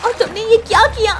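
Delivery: put-on high, fast, betrayed girl; a girl cheated, crying out; hurt accusation. (धोखा खाई लड़की का आरोप)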